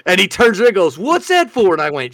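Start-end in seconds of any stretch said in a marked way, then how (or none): 1.0-1.7 much higher, louder startled question; startled Otis. Retold or imitated. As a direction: jump at the pop and ask.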